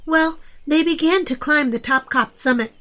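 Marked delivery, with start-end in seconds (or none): none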